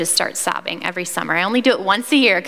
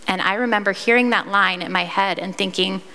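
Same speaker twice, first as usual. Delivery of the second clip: ordinary talk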